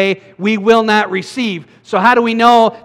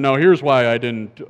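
voicing God; 6 semitones lower than usual. God explaining in heaven, voiced.

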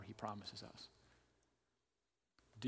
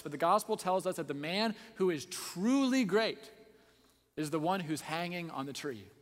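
loud, raised-pitch sermon climax; full-force preaching; 5 semitones higher, 10 dB louder than usual. Real, not acted. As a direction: preach it out, building to a ringing peak.

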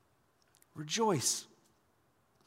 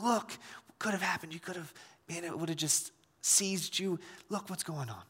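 hushed, much softer aside; gentle warning; 9 dB quieter than usual. Acted. God speaking to a repentant Cain, gently hushed. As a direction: show them the danger without scolding.

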